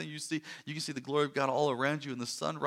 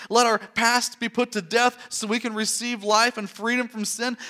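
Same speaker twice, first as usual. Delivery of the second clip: louder, driving sermon voice; full-force preaching; 5 semitones higher, 5 dB louder than usual. Acted. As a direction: preach it home to the congregation.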